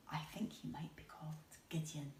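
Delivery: breathy secret guess, fast; whisper. Acted. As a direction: let them in on a secret.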